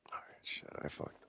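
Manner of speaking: breathy whisper under his breath; whispered curse. Real, not acted. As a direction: swear quietly so no one hears.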